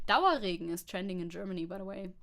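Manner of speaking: ordinary delivery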